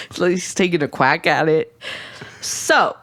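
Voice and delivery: usual speaking voice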